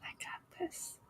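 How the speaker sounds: breathy whisper, no voice at all; whispered confidence. Pretend. Reader voicing the client's private pep talk in a whisper. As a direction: lean in and whisper it like a secret vow. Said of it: "show up and to say".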